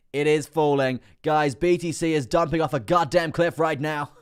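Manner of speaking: loud, high, drawn-out alarm; excited live commentary. Real, not acted. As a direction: call a crash like live play-by-play. Live alarm at price crash.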